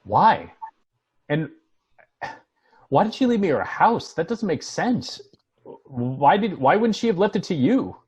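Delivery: confronting a friend who kept a secret; stunned disbelief. Acted. Shocked by the inheritance.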